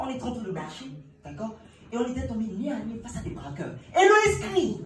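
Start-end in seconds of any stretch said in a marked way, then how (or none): 3.9-4.8 high, loud mock shriek; a hammed-up scream. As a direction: squeal it out in fake panic. Retold robbery, Héloïse screams.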